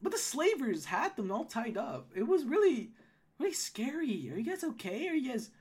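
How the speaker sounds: high-voiced gnome woman recalling a scare; Holly, concerned. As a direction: check on them anxiously in the gnome voice.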